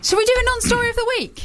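high segment intro. Acted. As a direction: pipe up high and brisk.

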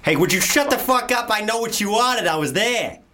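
hammed-up Boston tough guy; Boston accent. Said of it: "Do your Boston".

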